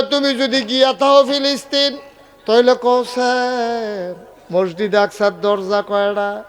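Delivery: high taunting question, stretched on তইলে; mocking challenge. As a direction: sneer the test at him. Unbelievers challenge the Prophet.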